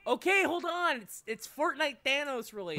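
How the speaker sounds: high, loud hyped-up; can barely contain the hype; excited. Real, not acted. Hyping up game news.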